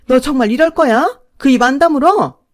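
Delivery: loud, high furious outburst; yelling at the daughter-in-law to shut up; angry yell. Acted. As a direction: shout her down to shut her up.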